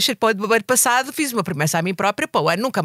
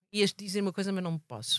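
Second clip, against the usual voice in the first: much softer, holding it back; hushed; 12 dB quieter than usual. Real, not acted. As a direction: drop to a near-whisper, coy.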